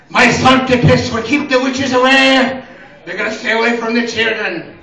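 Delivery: put-on comic Irish accent, starting loud and high. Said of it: "why the dog was Irish".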